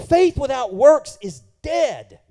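slow, loud, driving the word home; loud slow proclamation of "dead"; punched preached answer. Shown as a demonstration. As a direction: hammer each word of "Faith without works is dead" slowly. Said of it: "preached answer".

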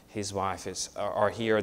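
ordinary delivery

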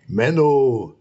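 sulky monster complaint; grumbling scold. Acted. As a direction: Menno as a grumpy monster. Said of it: "schimpft das Monster".

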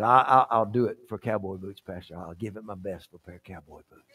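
very low, quiet, stammered promise; subdued agreement. Acted. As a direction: give in meekly under your voice. Gary reluctantly agrees.

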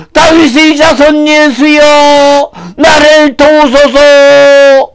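high, drawn-out cries for help; desperate shouting. Acted. Blind man calling to Jesus for help, desperate shouting. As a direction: cry out slowly at full stretch. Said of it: "하고 이렇게 소리를 쳤어요".

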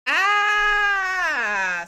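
loud, high cry of frustration; a real howl of exasperation; exasperated yell. Real, not acted. Exasperated explaining cat dynamics.

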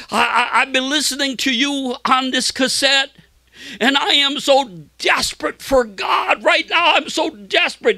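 high, impassioned plea building in urgency; retold anguish. Sick man pleading with well-known preacher, acted anguish. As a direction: act out a desperate man's outcry. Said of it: "he said it with great, like, anguish".

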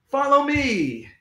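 high, drawn-out call; restrained shout. A narrator's reading. The principal leads children to the roof, calling out. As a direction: call them after you, stretching it out. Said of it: "he shouted".

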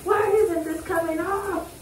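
high, slow, drawn-out complaint; exasperated whine. Real, not acted. Owner scrubbing muddy dog in shower, high exasperated whine. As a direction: stretch it up high in frustration.

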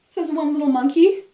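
put-on lively read-aloud for little kids, voices and all; storybook reading. Librarian reading a picture book to children, animated storytelling.

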